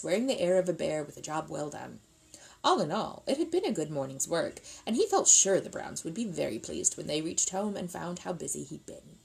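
ordinary delivery, nothing marked

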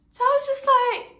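high, loud exasperated burst. Real, not acted. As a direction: throw it up high in disbelief. Exasperated outburst.

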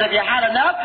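high, loud mock taunt; taunting shout. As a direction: tease him loudly from above. Taunting the doctor.

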